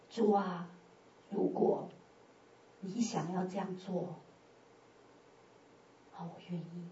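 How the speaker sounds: softly surrendering prayer; hushed surrender. Retold or imitated. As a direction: yield quietly to God. Solemn surrender prayer to God.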